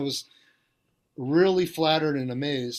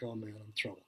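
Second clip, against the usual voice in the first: much softer, lower muttered dread; worried inner thought; 6 semitones lower, 14 dB quieter, faster than usual. Retold or imitated. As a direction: sink into a hushed groan of worry. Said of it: "I'm like".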